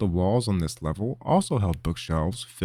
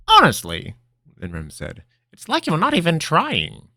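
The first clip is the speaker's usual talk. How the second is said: exasperated; 9 semitones higher, 6 dB louder than usual. Acted. Master scolding apprentice.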